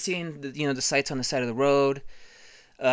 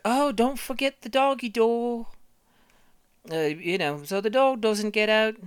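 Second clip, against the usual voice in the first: higher, slow mom reminder; lightly voiced mom; 10 semitones higher than usual. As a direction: remind him gently like a mother. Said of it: "she was like".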